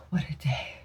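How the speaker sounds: sighed out, barely voiced; exhausted sigh. Real, not acted. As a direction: sigh it, drained. Tired end of the day.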